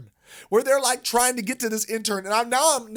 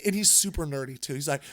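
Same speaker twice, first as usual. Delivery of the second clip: ordinary delivery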